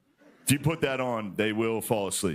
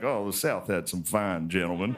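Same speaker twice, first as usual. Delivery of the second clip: put-on old Southern gentleman; 4 semitones lower than usual. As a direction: impersonate an old Southern historian, low-pitched. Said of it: "this old Southern guy".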